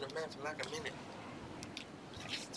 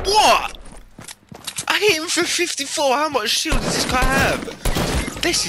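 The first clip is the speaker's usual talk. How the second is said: much higher, louder disbelief; shocked outcry; 7 dB louder than usual. Real, not acted. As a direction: yell it out in shock. Shocked outburst in fight.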